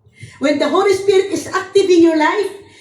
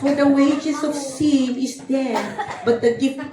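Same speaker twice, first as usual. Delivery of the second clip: put-on sweet voice; 2 semitones lower, 4 dB quieter than usual. Preacher practising sweetness at the mirror, failed sweet imitation. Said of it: "I try to say it".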